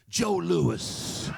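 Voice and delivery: put-on old fan's emphatic punchline; old man. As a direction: blurt the name proudly as an old man. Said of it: "The old man goes".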